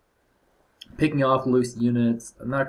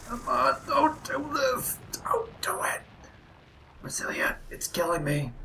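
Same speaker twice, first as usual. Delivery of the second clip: tense whisper; 14 semitones higher, 3 dB quieter than usual. Real, not acted. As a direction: beg under your breath for the units to survive. Begging the worm to spare units.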